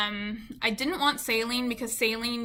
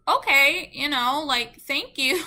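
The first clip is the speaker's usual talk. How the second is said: higher, louder taken-aback reaction; retold surprised thanks; 4 semitones higher, 7 dB louder, slower than usual. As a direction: re-enact being caught off guard by praise. Retelling her reaction to the compliment.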